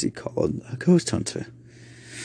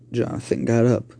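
ordinary delivery, nothing marked

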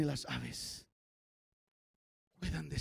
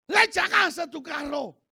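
high, loud exclamation; preacher's shout; 10 semitones higher, 9 dB louder than usual. Real, not acted. Preacher listing what God provides, shouted. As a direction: punch it out at a shout.

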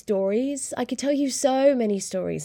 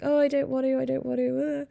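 higher whiny mock-fretting; mock panic; 5 semitones higher, faster than usual. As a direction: act out freaking out, lightly. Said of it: "and I'm like".

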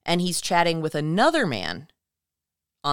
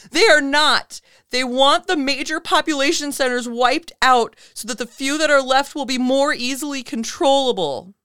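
much higher, loud insistent outburst; louder caller; 9 semitones higher, 6 dB louder than usual. Retold or imitated. Caller cuts Art off, raising his voice loud and high. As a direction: shout the claim over the host. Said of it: "he just gets even louder".

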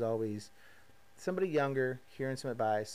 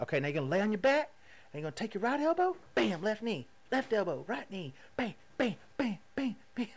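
higher, pumped-up trainer voice calling reps; imitating a coach; 8 semitones higher than usual. To the listeners, mimicking trainer Trey barking out the drill. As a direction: bark out the reps like a hyped trainer.

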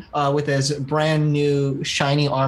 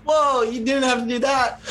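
startled; 8 semitones higher than usual. Acted. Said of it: "and Alex like".

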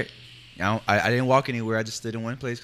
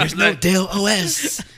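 high, drawn-out parroting of a friend; mocking mimicry; 7 semitones higher, 4 dB louder, slower than usual. Teasing a co-host over Dell, mocking mimicry. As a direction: mimic the objection slowly, pitched way up.

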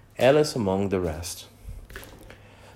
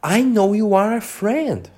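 higher, lightly voiced little insect; tiny voice; 9 semitones higher, 4 dB louder than usual. A narrator's reading. Tiny gnat assuring Alice it is her friend, lightly voiced and higher. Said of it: "the little voice said".

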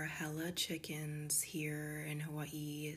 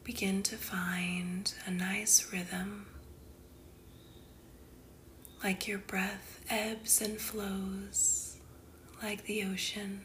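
guided meditation; 3 semitones higher, 3 dB louder than usual. Acted. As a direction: guide the listener unhurriedly into calm, phrase by phrase.

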